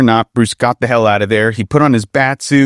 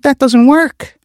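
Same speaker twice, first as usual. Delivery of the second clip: much higher and louder woman's voice, objecting; protesting female officer; 4 dB louder, slower than usual. Acted. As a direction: push back hard as Ramirez, up high. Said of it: "she protested".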